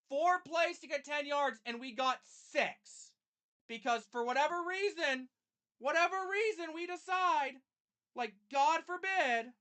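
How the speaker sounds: high, loud, drawn-out outrage; exasperated rant. Real, not acted. Fan fuming over four failed plays, exasperated rant. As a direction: stretch out each phrase in real disbelief.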